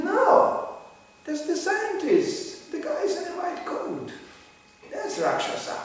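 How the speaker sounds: quoting the teacher. Retold or imitated. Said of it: "He said".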